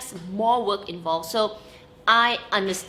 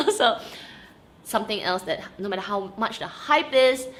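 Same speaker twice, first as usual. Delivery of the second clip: ordinary talk, nothing marked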